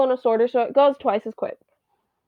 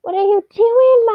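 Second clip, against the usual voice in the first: much higher, louder cutesy pet voice; 11 semitones higher, 7 dB louder than usual. Real, not acted. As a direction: coo it at the cat, high and loud. High baby talk to cat.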